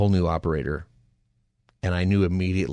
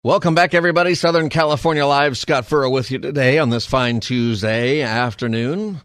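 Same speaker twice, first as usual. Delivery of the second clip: loud, higher announcer welcome; radio host intro; 5 semitones higher, 6 dB louder than usual. Acted. Radio host back from break.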